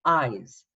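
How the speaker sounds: "eyes" as a drawn-out long i with z; sound eyes. Shown as a demonstration. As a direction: say the ize ending as "eyes". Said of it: "it makes the sound eyes".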